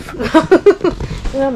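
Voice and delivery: real amused laugh; mẹ cười. Real, not acted. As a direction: burst out laughing. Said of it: "mom laughs at the move".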